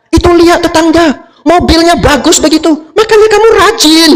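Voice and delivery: very high, strident acted scolding; nagging wife. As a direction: nag the husband at the top of your pitch. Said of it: "Dia ngomel-ngomel seharian". (istri mengomel dengan nada melengking)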